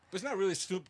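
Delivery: usual speaking voice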